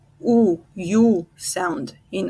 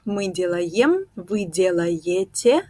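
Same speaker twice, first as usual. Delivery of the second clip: ordinary talk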